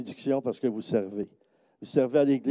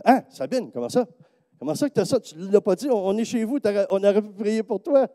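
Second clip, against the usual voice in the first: high, amazed scolding of a friend; astonished and lightly reproachful; surprised scolding; 6 semitones higher than usual. Retold or imitated. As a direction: tease her for hiding it. Preacher retells scolding Sabine.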